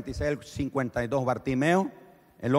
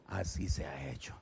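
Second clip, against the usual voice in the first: soft, breathy whispered grant; Jesus granting sight, softly whispered; 10 semitones lower, 11 dB quieter than usual. Acted. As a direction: whisper it with gentle authority.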